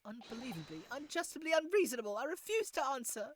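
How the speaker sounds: high, loud, fast protest; indignant outburst. Acted. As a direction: build into an outraged prisoner's rant. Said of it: "Forgive me for that outburst".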